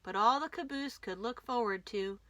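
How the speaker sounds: plain reading voice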